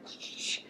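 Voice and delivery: breathy shushing sound, no voice. Retold or imitated. Telling herself to calm down about the book, soft shush. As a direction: hush yourself with a soft shh. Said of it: "I was like".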